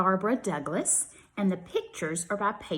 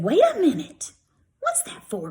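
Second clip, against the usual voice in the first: much higher, alarmed cry; alarmed child; 11 semitones higher, 5 dB louder than usual. Acted. Grady seeing Grandpa's pocket knife, alarmed. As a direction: yelp it in alarm.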